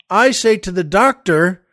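ordinary talk, nothing marked

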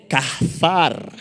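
"cazar" drawn out, breathy H between the syllables; Hebrew-style pronunciation. Shown as a demonstration. Preacher introduces a Hebrew word, "cazar" with drawn-out H and stressed last syllable. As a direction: say "cazar" slowly as cah-HAR, second A stressed. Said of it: "con una H en medio".

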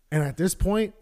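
usual speaking voice

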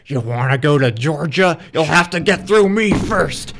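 lightly voiced gatekeeping dragon; mild mock monster threat; a hint of a dragon. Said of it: "the dragon's like".

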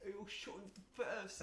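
mengutip diri sendiri. Retold or imitated. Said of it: "I'll never forget what I said".

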